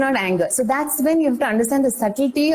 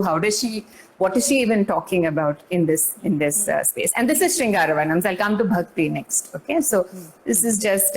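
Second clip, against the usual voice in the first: ordinary talk, nothing marked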